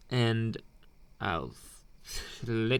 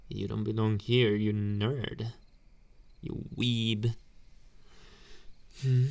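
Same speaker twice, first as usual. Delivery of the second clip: ordinary talk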